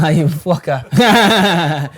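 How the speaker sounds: drawn-out, loud, laughing "you fucker" at a prank; slow, half-laughing yell of fond outrage; fake-angry shout at a friend, cracking up; laughing mock outrage. Real, not acted. Mock outrage at a joking manager.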